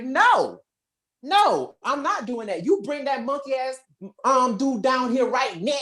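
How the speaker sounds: mock villain refusal. Elgar refusing the Rangers' trade, hammed up. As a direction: play the bratty villain barking orders.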